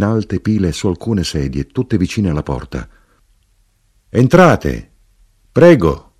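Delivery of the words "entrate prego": clear, raised welcoming call; chiara voce d'invito. A narrator's reading. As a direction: invite them in, higher and louder. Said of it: "Una chiara voce invitò". (invito a voce chiara e alta)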